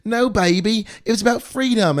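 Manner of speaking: high swinging British playboy; Austin Powers voice. Retold or imitated.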